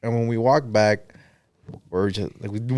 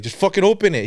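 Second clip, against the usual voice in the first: higher-pitched, exasperated imitation; retold pissed demand; 7 semitones higher than usual. Pissed friend insisting the car be opened, exasperated. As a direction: repeat the order with pitch jumping up. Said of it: "He was pissed, dude".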